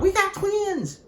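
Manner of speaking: high, loud whoop imitating a hyped-up guy; mock thrilled holler; excited yell. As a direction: yell it out high-pitched, like an over-excited storm chaser. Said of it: "gets real excited and yells out".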